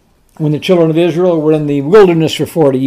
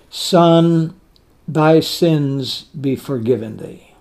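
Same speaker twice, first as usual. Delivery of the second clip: softer, slow and loving; tender; 4 semitones lower, 7 dB quieter, slower than usual. Shown as a demonstration. Jesus speaking to the paralytic, soft and slow with love. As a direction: let each word carry compassion, gently. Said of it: "full of love".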